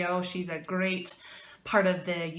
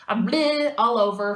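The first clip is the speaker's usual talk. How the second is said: frustrated groan; 2 semitones higher, 8 dB louder than usual. Retold or imitated. Retelling her frustration at botching.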